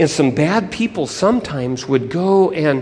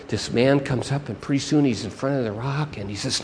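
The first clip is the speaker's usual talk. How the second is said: ordinary delivery, nothing marked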